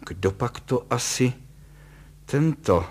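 faltering mock-dignified husband; grumbling composure. A narrator's reading. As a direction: fumble for the word while keeping up dignity. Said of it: "bručel pan Kalous, snaže se zachovat důstojný klid".

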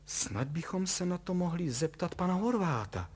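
high, tentative suggestion; hesitant. A narrator's reading. Wife suggesting they consult a neighbour, hesitant. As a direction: float the idea uncertainly. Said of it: "ozvala se nejistě".

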